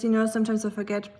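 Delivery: ordinary delivery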